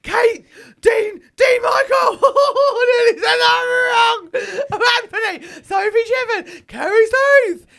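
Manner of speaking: high, loud, excited shouting; Stacey Solomon shouting names with glee. Acted. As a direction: shriek the names like an overexcited TV presenter. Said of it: "Stacey Solomon".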